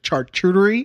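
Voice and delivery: "charcuterie" mangled with a hard ch; mispronunciation. Retold or imitated. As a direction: stumble through "charcuterie" as char-chu-ter-ee. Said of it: "I always say it wrong".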